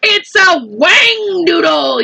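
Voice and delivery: high, slow excited yell. A narrator's reading. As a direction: shout out the find. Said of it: "yelled the head of the fire department".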